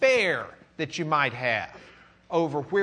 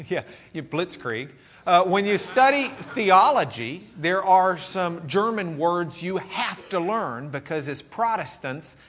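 usual speaking voice

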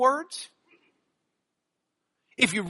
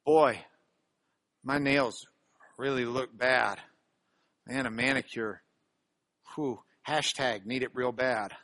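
lower, softer, lightly voiced teen complaining; imitating a teenager; 10 semitones lower, 6 dB quieter than usual. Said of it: "I think it went like this".

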